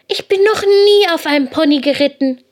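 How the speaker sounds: high, loud little-hare voice; amazed excitement. A narrator's reading. Hare amazed at first pony ride.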